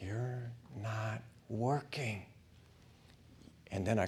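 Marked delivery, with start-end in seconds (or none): 0.0-2.3 "You're not working" said slow and much softer, falling on "working"; a dull, heavy murmur of despair, drawn out; bitter brooding. Retold or imitated. Brooding thought after the burial.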